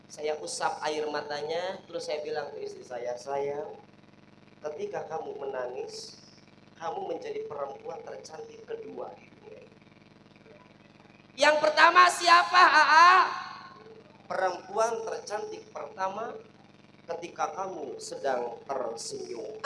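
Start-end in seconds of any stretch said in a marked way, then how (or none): 3.1-9.8 soft, low and tender, soothing a crying wife; tender husband. Retold or imitated. Husband softly comforting his crying wife, tender and low. 11.4-13.1 high, loud, eager question; eager wife. Retold or imitated. Wife asking her husband who is first, excited and loud. 14.2-19.6 low, slow and sweet; tender husband. Retold or imitated. Husband finishing a compliment to his wife, slow and tender.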